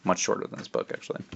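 soft, breathy author aside; whispered aside. Real, not acted. Author whispering a joke about his own book. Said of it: "whispered author aside".

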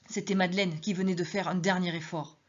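usual speaking voice

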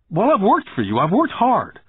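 put-on high, emphatic complaint of a wronged boy; acted boyish indignation, pitched well up; indignant protest. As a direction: protest hotly as the narrated child. Boy protesting to his boss.

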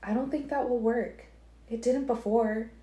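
put-on Alice cautioning Edward about the girl's blood; Alice, warning. A narrator's reading. Alice voiced.

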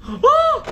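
loud, very high "oh!"; sudden high shriek of fright; startled yelp. Real, not acted. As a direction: yelp as if jumped by something. Jump scare in horror game.

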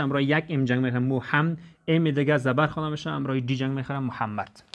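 ordinary talk

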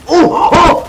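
two sudden high, loud cries; startled yelps. Real, not acted. Player caught off guard in game, startled yelps. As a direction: yelp in shock, twice.

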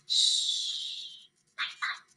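whispered shh and soft night night; soft goodnight whisper; bedtime hush. Acted. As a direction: hush them like tucking kids in. Sun went down, bedtime hush.

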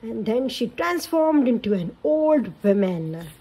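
put-on lively read-aloud for kids; storybook narration. A narrator's reading. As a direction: tell it like a bedtime story. Narration continues.

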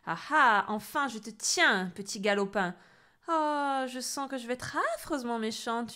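loud gloating witch. Acted. Witch cornering the boy, gloating. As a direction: relish the victory. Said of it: "jubila-t-elle".

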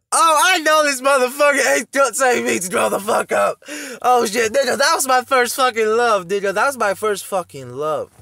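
very loud, high-pitched outburst; real worked-up screaming rant; outraged shouting. Real, not acted. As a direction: yell it at full volume, upset. Outburst at the played video.

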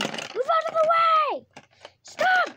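loud high yell to clear the way; frantic shout. Real, not acted. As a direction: bark at them to move. Yelling at player.

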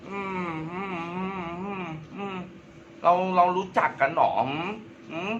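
high, dismissive humming; snooty refusal. Acted. Star asked to promote a song, snooty refusal. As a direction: brush them off like a vain star.

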